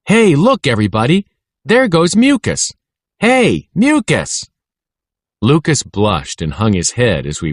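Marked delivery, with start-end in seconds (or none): 0.1-4.4 put-on kids' taunting cry. A narrator's reading. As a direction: yell it across the playground to mock him. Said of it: "a familiar cry went up".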